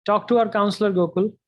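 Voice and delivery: usual speaking voice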